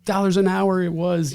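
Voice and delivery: high, loud exasperated mom voice; mom's outburst. Retold or imitated. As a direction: squeal it like a scandalized immigrant mom. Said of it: "she's like".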